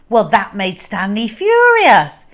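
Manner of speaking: ordinary delivery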